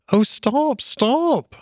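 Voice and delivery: very high, rapturous cry; overwhelmed with delight; ecstatic. A narrator's reading. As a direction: cry out in ecstasies, pitch soaring. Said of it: "cried the Mole in ecstasies".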